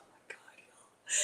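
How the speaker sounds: breathless whisper of delight; awed whisper. Real, not acted. To the stream viewers over the finished dish, an awed whisper. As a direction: whisper it like the taste floored you. Tasting the Biscoff French toast.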